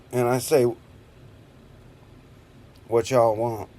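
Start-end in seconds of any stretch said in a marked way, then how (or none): none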